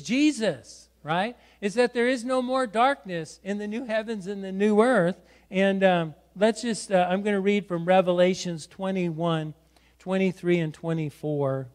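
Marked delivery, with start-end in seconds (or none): none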